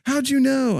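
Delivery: very high, loud comic outburst; mock yell. Talking back to a radio ad, hammed-up high yell.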